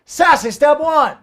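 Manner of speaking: slow, high, loud sassy announcement. Pretend. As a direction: flaunt each step with hammed-up sass. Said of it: "The sassy way, just for all of you".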